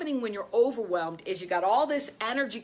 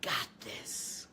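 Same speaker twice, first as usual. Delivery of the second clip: soft unvoiced whisper; 6 semitones lower, 10 dB quieter than usual. Shown as a demonstration. Coach modelling the inner whisper for listeners. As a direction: breathe the words out in a whisper.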